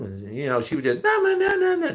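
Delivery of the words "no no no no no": very high squeaky repeated no's; high-pitched mimicry. Imitating his coworker's dismissive no's, very high squeak. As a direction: squeak it way up like she did. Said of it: "That's how it sounded".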